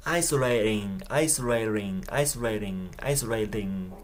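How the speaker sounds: isolating, tongue tapping the T like a quick D; American tapped T. Shown as a demonstration. Teacher contrasting the American flap T. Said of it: "アメリカだとタップTになって".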